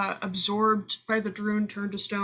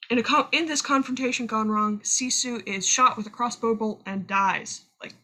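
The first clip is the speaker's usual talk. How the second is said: ordinary talk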